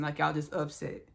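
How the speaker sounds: normal talking voice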